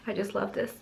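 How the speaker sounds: "I just love this" said softly under her breath; soft aside. Real, not acted. Vlogger murmurs softly to her camera about the eye base. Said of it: "restarts the line".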